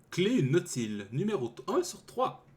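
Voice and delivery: ordinary talk, nothing marked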